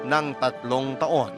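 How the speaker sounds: usual speaking voice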